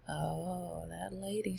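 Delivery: ordinary talk, nothing marked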